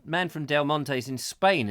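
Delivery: ordinary talk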